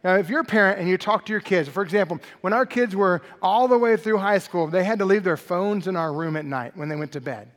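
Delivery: normal talking voice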